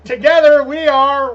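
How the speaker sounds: big pretend host build-up; mock announcer. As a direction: announce the duo with hammed-up fanfare. Trial run of podcast intro.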